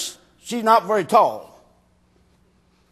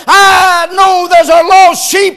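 fiery preaching; 4 semitones higher, 5 dB louder than usual. Acted. Preacher proclaiming the shepherd's search to the church, full-force preaching. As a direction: preach it out to the congregation, building and slowing.

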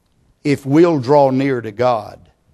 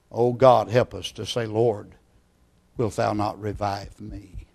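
low plea, fading very soft; hushed prayer; 4 semitones lower, 7 dB quieter than usual. Real, not acted. Preacher asking God to help the people pray, hushed. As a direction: drop into quiet, earnest prayer. Prays aloud for revival.

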